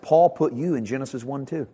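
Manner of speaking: ordinary delivery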